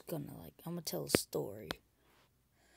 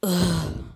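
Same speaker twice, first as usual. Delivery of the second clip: loud groan; 3 semitones higher, 14 dB louder than usual. Acted. Friend reacts in acted quote.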